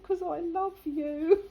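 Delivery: high, drawn-out imitation of a soppy plea; naughty boy. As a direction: play the naughty lad declaring affection. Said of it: "this naughty boy who had said".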